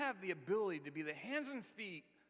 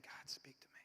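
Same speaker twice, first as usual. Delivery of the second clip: whispered prayer; 11 dB quieter than usual. Acted. Quiet prayer to God.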